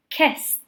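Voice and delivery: "kissed" ending in a crisp T; one syllable, "kissed" said as "kist"; final T sound. Shown as a demonstration. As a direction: finish "kissed" on T, no E vowel. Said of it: "we pronounce it with a T".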